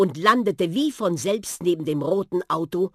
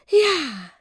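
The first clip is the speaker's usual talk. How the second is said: much higher, breathy sighed yes; Susi's sigh; 12 semitones higher, 5 dB louder than usual. A narrator's reading. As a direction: sigh it sweetly as the little car. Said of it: "seufzte Susi mit der schönen Stimme".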